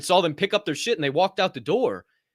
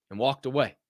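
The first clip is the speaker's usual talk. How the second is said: ordinary talk, nothing marked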